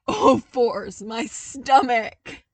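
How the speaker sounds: loud, slow, winded from laughing; laughing hard. A narrator's reading. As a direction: clutch your aching stomach mid-laugh. Laughing fit, aching stomach.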